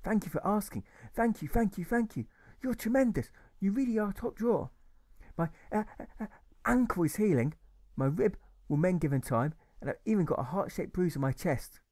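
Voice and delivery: put-on high, eager young boy; a grateful boy gushing thanks. Alfred thanks the German who saved him, eager boy voice.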